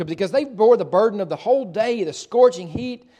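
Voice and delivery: normal talking voice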